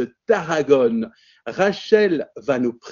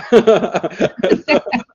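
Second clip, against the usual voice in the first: light laugh; 4 semitones higher, 5 dB louder than usual. Real, not acted. Host laughs reassuringly.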